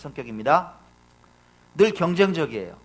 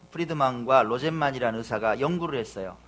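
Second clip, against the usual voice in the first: usual speaking voice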